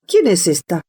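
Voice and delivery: the Queen asks, low and severe; a stern royal demand, said low; stern question. A narrator's reading. Queen to the Jack of Hearts: a low, stern question. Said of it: "La reina preguntó con severidad".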